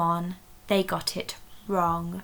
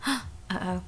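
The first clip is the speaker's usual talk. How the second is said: gasp; 7 dB quieter than usual. Acted. Spell goes wrong.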